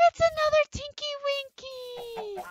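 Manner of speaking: high, loud excited squeal. Real, not acted. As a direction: squeal with delight. Sees another Tinky Winky.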